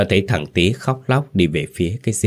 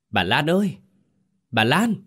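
high, tearful cry for help; choked sobbing call; 8 semitones higher than usual. A narrator's reading. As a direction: call out as if choking back sobs. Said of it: "Một tiếng khóc nấc nghẹn". (tiếng gọi khóc nấc nghẹn, giọng cao)

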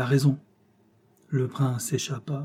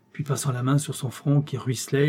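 ordinary delivery, nothing marked

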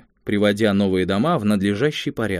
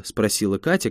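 plain reading voice

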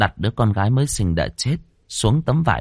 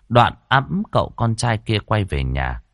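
plain reading voice

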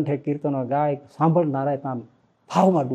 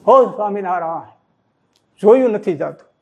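grieving father's cry; 4 semitones higher, 4 dB louder than usual. Retold or imitated. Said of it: "એમ ફુલાબા બોલ્યા".

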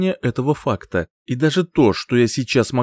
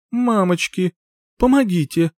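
high, panicked plea for help; terrified cry; 8 semitones higher than usual. Acted. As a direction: cry out in fear. Heroine's terrified inner cry.

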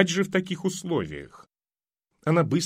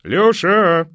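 loud call over the fence, pitched up; shouted; 6 semitones higher, 9 dB louder than usual. Acted. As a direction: call out the name from next door. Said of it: "закричали из-за забора".

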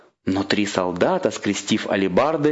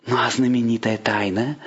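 ordinary delivery

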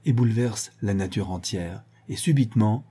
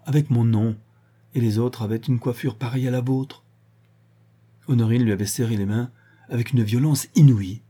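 ordinary delivery, nothing marked

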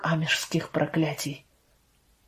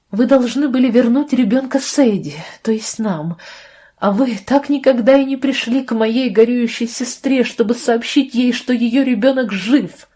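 raised, higher-pitched reproach; indignant accusation; 4 semitones higher, 4 dB louder than usual. Acted. Sister reproaches the doctor over the hidden baby, indignant. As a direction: confront them with mounting indignation, louder.

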